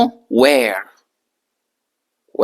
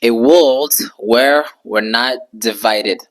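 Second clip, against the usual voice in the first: slow, careful model sentence; slow model phrase; 3 semitones lower, slower than usual. Shown as a demonstration. English teacher modelling the sentence slowly. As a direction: say it slowly so students can copy. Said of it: "Alors, [name] dit".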